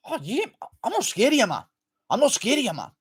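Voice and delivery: high put-on taunting voice; squeaky mock bravado; taunting in a silly high voice.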